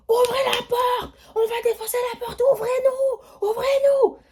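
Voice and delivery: high, loud crying shouts; imitated frantic banging yells; frantic shouting. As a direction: yell through the door in a panic. Imitating people shouting at door.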